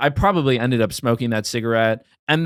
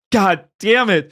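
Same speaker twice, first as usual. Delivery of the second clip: drawn-out exasperated curse, higher; 6 semitones higher, 4 dB louder, slower than usual. Retold or imitated. Retelling being talked into buying Newports, exasperated. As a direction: curse slowly in mock exasperation. Exasperated reaction in retold story.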